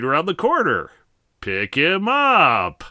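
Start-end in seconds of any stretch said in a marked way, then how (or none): 1.4-2.8 slow punchline. Acted.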